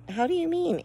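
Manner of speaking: high startled question; a wife caught off guard, pitched high. A narrator's reading. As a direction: jump at the news. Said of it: "asked Homily, startled".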